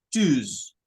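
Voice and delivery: "tus" ending in a buzzing z; Z sound. Shown as a demonstration. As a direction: buzz the s in "tus". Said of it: "Con un sonido de Z".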